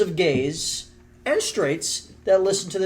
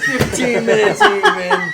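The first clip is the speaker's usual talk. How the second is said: slow, much higher, laughing; mock announcer; 7 dB louder, much slower than usual. Host announces the episode length, hammed-up slow announcer. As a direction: announce it like a grand milestone.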